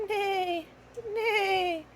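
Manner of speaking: high whinnying neigh; horse neigh. Shown as a demonstration. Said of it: "What does a horse say".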